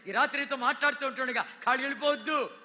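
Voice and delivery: loud, urgent sermon delivery; fervent pulpit preaching; full-force preaching. Acted. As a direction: preach it hard to the crowd. Preaching urging.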